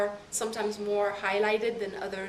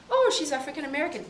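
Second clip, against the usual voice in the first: quick, higher, louder mock stranger voice; imitating a stranger's guess; 6 semitones higher, 6 dB louder, faster than usual. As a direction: toss it off fast like a stranger sizing her up. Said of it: "then I'll be like".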